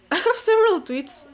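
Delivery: high, loud laughing repeat. Real, not acted. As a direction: laugh through the line.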